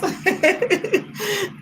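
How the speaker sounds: a high, airy burst of laughter; real amused high laugh. Real, not acted. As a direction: let a high giggle escape. Laughs after teasing line.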